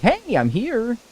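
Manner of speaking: villager voice. Retold or imitated. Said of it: "she was like".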